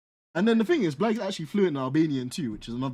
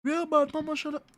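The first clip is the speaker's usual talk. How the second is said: much higher, jokey Jamaican patois voice; high-pitched mock Yardie, rhyming at a friend; 11 semitones higher, 4 dB quieter than usual. Mocking Blag's Clarks in a high Yardie voice.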